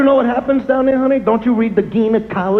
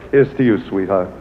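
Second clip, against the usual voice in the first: ordinary talk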